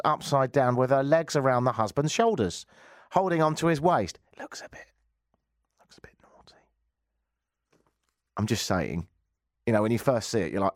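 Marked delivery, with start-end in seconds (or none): 4.3-6.6 whispered, conspiratorial aside; naughty whispered aside; cheeky whisper. Pretend. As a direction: lean in and whisper it.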